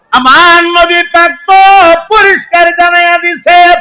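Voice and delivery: impassioned high-pitched preaching; full-force preaching. Acted. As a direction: ring it out on a high sustained pitch.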